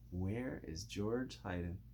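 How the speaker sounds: ordinary delivery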